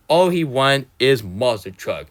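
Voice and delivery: ordinary talk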